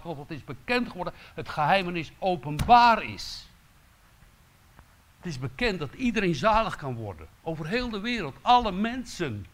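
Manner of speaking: luider, hoger predikend met nadruk; vurige preek. Acted. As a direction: preek het met kracht en vuur. Preacher raises voice to congregation. (luid en hoger predikend tot de gemeente)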